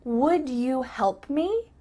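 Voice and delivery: slow, separate "Would you", rising; measured polite question; full polite form. Shown as a demonstration. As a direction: ask "Would you help me" carefully and politely. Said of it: "ask a polite question".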